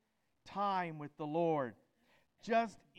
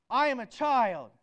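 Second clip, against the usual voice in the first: much louder, rousing declaration; with enthusiasm; 4 semitones higher, 12 dB louder than usual. Shown as a demonstration. Preacher leading the congregation in a declaration, loud and enthusiastic. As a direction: proclaim it with full enthusiasm. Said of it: "Now let's do it with some enthusiasm".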